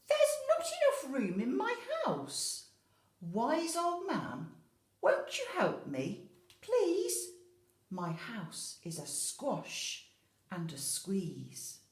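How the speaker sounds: put-on high, loud, laughing fussy old lady; a put-upon old woman begging for help; old lady's complaint. Old lady pleading with the wise old man, high fretful voice. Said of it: "She said".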